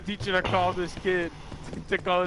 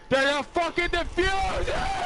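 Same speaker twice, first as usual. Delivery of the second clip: high-pitched, drawn-out alarm call; urgent warning; 11 semitones higher, slower than usual. Real, not acted. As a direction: stretch it out high, alerting your teammates. Warning teammates during the game.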